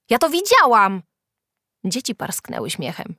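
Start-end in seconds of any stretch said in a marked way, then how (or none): 0.1-1.0 high, loud protest; indignant cry. A narrator's reading. Julka insists to mocking classmates, crying out. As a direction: insist loudly, pitched high. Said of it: "zawołała Julka".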